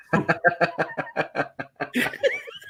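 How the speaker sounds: high, rising burst of laughter; real laugh. Real, not acted. Laughing at his mates' LinkedIn bit. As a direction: crack up at the joke.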